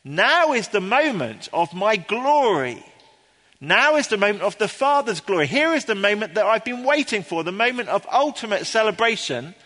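high, loud proclamation of victory; great triumph. Acted. Preacher voicing Jesus hailing his moment of glory, triumphant. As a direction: declare it exultantly, pitch lifted. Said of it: "it's a statement of great triumph".

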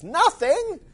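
loud, high, rising outraged roar; roaring in disbelief. Acted. Battle machine to Marvin, loud high incredulous roar. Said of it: "roared the battle machine".

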